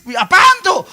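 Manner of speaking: jokey loud what's that; mock Indonesian reaction. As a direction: mimic a startled Indonesian. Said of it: "Kalau ketemu orang Indonesia".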